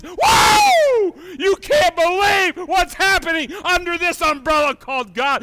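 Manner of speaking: very high, loud cry of wonder; amazed shout. Acted. Preacher marvelling at life under God's umbrella, amazed shout. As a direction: burst out in amazement.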